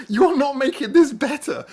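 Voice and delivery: high, loud, laughing; laughing protest. Retold or imitated. Detainee telling the translator he is not helping, laughing. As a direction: laugh it out in disbelief.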